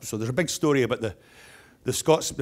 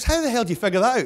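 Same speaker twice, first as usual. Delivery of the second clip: high, fast, baffled outburst; mock astonishment; 11 semitones higher, 5 dB louder, faster than usual. Public works guy questioning a bidder's price, mock astonished. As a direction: act out an incredulous official, pitched high and quick. Joke official reacts to huge price.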